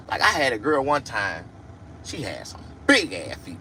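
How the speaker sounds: ordinary talk, nothing marked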